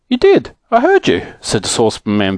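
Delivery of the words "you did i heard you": high, peeved insistence; vexed. Acted. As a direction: insist crossly, pitched right up.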